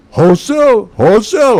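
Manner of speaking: high, loud mock delight; hammed-up eager exclamation; Morozumi excited. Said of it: "He knew that word".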